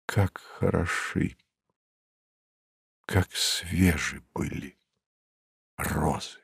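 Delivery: very soft, low, broken by long pauses; dying-away refrain. A narrator's reading. Bereaved narrator recalls the roses one last time, barely audible. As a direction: let the line fade almost to nothing. Final refrain after all have died.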